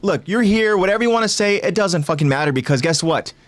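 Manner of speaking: high, dismissive brush-off; condescending. Acted. Eren dismissing whatever Hanji wants to say, condescending. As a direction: wave away their opinion from above. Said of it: "condescending, like".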